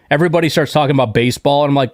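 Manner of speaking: normal talking voice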